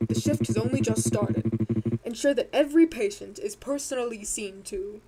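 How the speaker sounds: slow, very deep villain; deep drawn-out evil boss; cartoon villain voice. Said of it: "cartoon villain voice".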